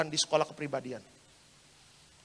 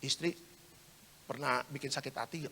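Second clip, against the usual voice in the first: low, hushed question; confidential murmur; 3 semitones lower, 7 dB quieter than usual. Retold or imitated. Pastor secretly asking the husband about his wife, confidential murmur. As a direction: ask it secretly, keeping it soft and low. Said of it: "Saya bisik-bisik sama bapaknya".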